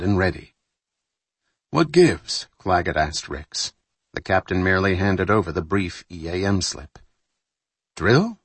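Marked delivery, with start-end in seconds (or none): 1.7-2.5 higher, louder puzzled question. Acted. Puzzled officer asks. 8.0-8.4 higher surprised doubting query; doubtful question. Acted. Questioning a drill.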